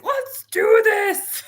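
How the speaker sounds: hammed-up eager shout; imitating an eager kid. Son waking full of energy, mock shout. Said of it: "He's like".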